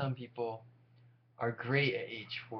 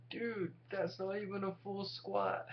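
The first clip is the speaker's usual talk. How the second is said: judgy gym onlooker; 9 semitones higher than usual. Pretend. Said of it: "they're like".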